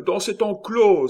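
high, loud urgent cry; exclaiming. A narrator's reading. Miton pointing out a refuge, urgent cry. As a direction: blurt the escape route at full pitch. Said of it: "s'écria Miton".

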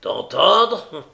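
loud, high, incredulous bark; angry echo. Acted. Brigand repeats Nancy's word back, furious. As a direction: throw the word back at her. Sykes echoes her demand furiously.

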